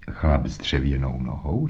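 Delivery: low, soft questioning echo; understated surprise, kept quiet; mildly surprised. A narrator's reading. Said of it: "mírně překvapen". (mírně překvapená otázka)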